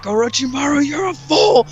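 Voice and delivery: high, angry cry rising on FOOL; Sarutobi. Retold or imitated. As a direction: imitate an old master's furious rebuke. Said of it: "gets so angry after he cries a bit".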